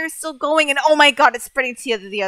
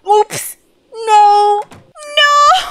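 high, louder despairing cries of no; horrified no; 8 semitones higher, 6 dB louder, much slower than usual. Real, not acted. Gamer realizing she deleted the urn, high despairing cries. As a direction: wail in horror at the mistake.

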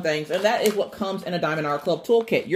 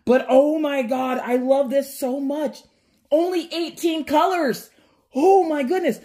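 gushing; 6 semitones higher than usual. Real, not acted. Gushing over the picture again.

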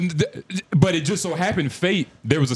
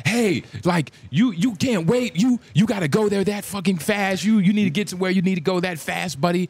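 higher-pitched imitation of an angry driver; mock yelling; 5 semitones higher than usual. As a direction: retell his scolding in a raised pitch. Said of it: "you fucking yelling at me".

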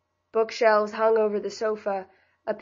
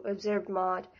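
ordinary delivery, nothing marked

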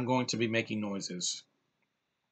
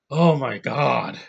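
slow, drawn-out, louder swoon in French-free awe; swooning oh my God; 6 dB louder, much slower than usual. Real, not acted. Fan gushing about his favorite actress, slow swooning sigh. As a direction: let it melt out slowly, overwhelmed.

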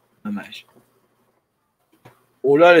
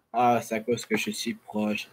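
normal talking voice

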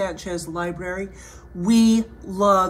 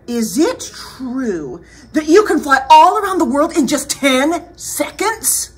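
raised, wide-eyed question; flattering princess; 6 semitones higher, 7 dB louder than usual. Acted. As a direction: goad the dragon with admiration. Voices Elizabeth questioning the dragon.

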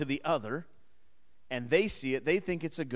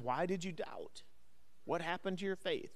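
soft, hushed retold question; quietly voiced reproach; gentle questioning; 3 semitones higher, 9 dB quieter than usual. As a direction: drop the volume and ask the doubter. Voices Jesus questioning doubting Peter.

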